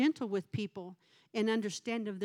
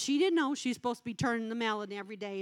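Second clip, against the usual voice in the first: ordinary talk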